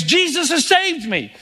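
lightly voiced man pouring out his story; a hint of an excited convert; joyful testimony. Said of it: "What he really meant is".

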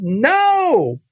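high, defiant "No."; boy shouting back at the evangelist, high and defiant; child's refusal. Retold or imitated. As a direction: refuse her again like a scared child. Boy refuses a second time.

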